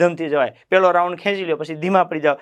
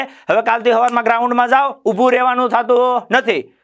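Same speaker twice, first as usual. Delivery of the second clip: louder, higher, preaching at full force; fiery exhortation; 5 semitones higher, 3 dB louder than usual. Acted. Coach scolds recruits who stop running, preaching hard. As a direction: shake them awake about their parents.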